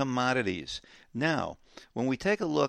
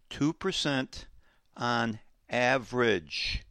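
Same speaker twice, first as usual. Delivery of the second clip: "on average" drawn out slowly; slow, weighty "on average"; stress on average; slower than usual. Shown as a demonstration. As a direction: hammer home "on average". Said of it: "Did you hear the word that I tagged".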